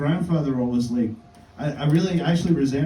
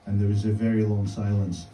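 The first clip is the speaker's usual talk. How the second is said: usual speaking voice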